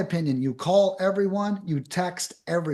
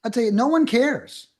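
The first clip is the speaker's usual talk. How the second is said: emphatic; 5 semitones higher, 5 dB louder than usual. Real, not acted. Emphatic point about wordy scripts.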